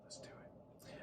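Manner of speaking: breathless eager whisper. Retold or imitated. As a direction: hush it like a thrilled secret. Eagerly buying stock in game.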